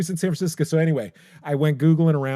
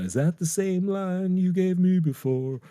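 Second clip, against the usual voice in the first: singer imitation; 9 semitones higher than usual. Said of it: "[name] starts with".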